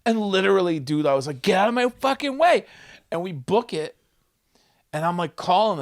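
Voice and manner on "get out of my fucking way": loud, high demand; retold urgent yell. Fleeing comic to old woman blocking him, urgent yell. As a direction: push past them with a raised voice.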